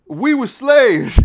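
high, loud, drawn-out outburst; indignant shout. Acted. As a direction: throw the answer back at full volume. Acted indignant reply to his question.

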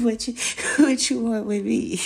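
loud, high incredulous cry; laughing outcry. Real, not acted. Asking the model guy, loud and incredulous. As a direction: blurt it out half laughing.

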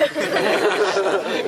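laugh. Real, not acted. Laughs at Jesus's joke.

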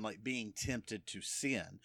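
usual speaking voice

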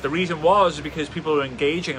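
ordinary delivery

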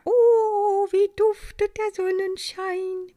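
high, loud oh of wonder; delighted gasp. A narrator's reading. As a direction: breathe in the sunshine happily. Maya delighted.

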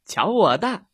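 loud, confident shout. A narrator's reading. Rabbit shouts to his scared friend, bold shout. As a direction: shout it out boldly. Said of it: "兔子大喊一声".

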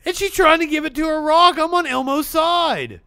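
champion the puppet loudly, stretching the words; mock outrage. Host taking Elmo's side over a rock, mock outrage. Heated defence of Elmo.

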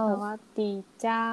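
imitated Northern Thai lilt, long rising เจ้า; drawn-out Northern Thai. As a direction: copy the stretched Northern Thai greeting. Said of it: "長く、ちょっとゆっくりめに話すのがコツ".

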